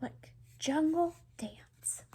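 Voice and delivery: slow hushed pointing; whisper. Real, not acted. Showing the child the page, slow whisper.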